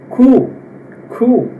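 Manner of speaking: "Q" said "ku", as if blowing; blown Q. Shown as a demonstration. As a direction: puff out "Q" like blowing on something. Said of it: "como se a gente estivesse assoprando alguma coisa".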